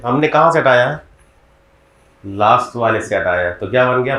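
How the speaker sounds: ordinary talk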